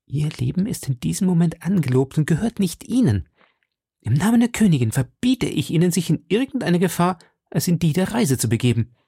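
a lightly voiced woman giving a firm command; commanding young woman. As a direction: forbid him in the queen's name. Queen's messenger forbids him.

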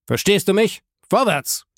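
put-on high, sharp order to the child; stern father ordering his son out; harsh command. A narrator's reading. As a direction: send the boy out with a hard command. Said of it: "mit so hartem Ausdruck".

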